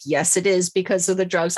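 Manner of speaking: normal talking voice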